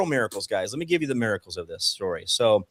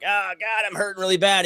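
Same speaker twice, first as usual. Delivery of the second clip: mock pained plea; 7 semitones higher than usual. Retold pain to doctor.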